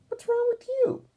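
put-on high, loud little-girl squeal; little sister voice. DW quizzing her sick brother Arthur, high and loud. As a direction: play DW as a bossy little kid, way up high.

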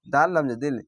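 usual speaking voice

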